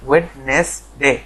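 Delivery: Wednesday said slowly as wedn-es-day; slow correct demo. Shown as a demonstration. Teacher slowly models Wednesday for students. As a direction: spell out Wednesday syllable by syllable, slowly. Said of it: "এটার উচ্চারণ হবে Wednesday".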